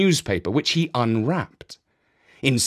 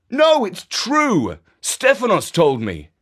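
insisting; 8 semitones higher, 4 dB louder than usual. A narrator's reading. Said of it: "insisted Jeff".

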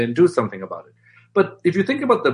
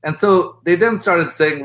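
ordinary talk, nothing marked

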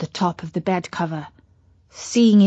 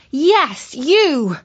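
Katy's voice; 6 semitones higher than usual. Acted. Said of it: "said Katy".

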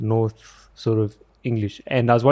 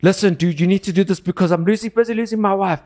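pitched well up, rushed and pleading; a man losing it, rattling off urgent orders; frantic Ivan on the phone; 9 semitones higher than usual. Pretend. Ivan begging a worker to fetch cement, frantic and high.

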